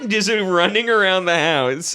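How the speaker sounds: usual speaking voice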